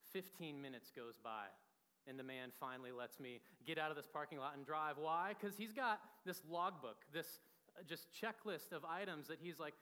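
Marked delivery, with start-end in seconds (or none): none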